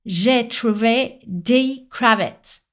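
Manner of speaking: put-on slow English-accented French, crevettes drawn out; anglophone learner's accent. Pupil repeating for a puzzled teacher, slow English-accented French. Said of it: "Il a donc répété".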